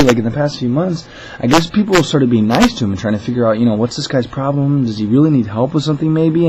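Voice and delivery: ordinary talk, nothing marked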